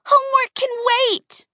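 high, loud gleeful cheer. A narrator's reading. Girls cheering as they dash for coats, high loud. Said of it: "they cheered".

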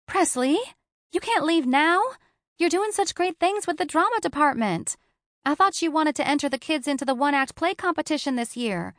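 a touch of a worried best friend; Capri, friend. A narrator's reading.

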